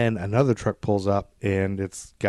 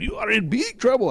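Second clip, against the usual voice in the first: high mock-Scottish yell; Scottish accent; 3 dB louder than usual. Official yelling from the truck, hammed-up Scottish accent. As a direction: shout a threat in bad Scottish. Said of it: "I guess he's Scottish".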